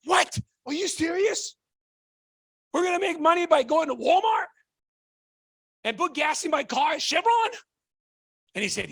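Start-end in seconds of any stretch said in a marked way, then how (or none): 0.0-7.5 excited yelling. Retold or imitated. Telling the gym crowd about Rob's idea, shouting in excitement. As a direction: yell it like you just heard the best news. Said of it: "started yelling".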